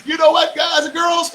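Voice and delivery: loud, high call after a laugh. Real, not acted. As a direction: shout to everyone while laughing. Laughing, calling out to listeners.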